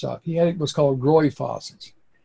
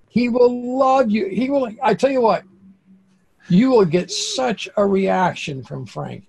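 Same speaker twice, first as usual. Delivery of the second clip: eager sales pitch; 8 semitones higher, 3 dB louder than usual. Retold or imitated. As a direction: talk it up like a sure thing. Urging a client to tip worker.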